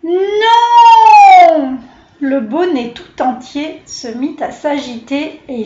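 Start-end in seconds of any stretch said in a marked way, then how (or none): 0.0-1.8 loud, high, drawn-out no; a crowd crying out no at once; shouted refusal. A narrator's reading. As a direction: animals in the hat shouting no at the flea. Animals refuse the flea.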